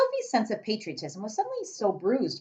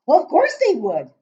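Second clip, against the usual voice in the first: much louder, higher giant shout; 10 semitones higher, 12 dB louder than usual. Acted. Giant shouting at Sophie, loud. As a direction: shout it back at her. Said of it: "the giant shouted".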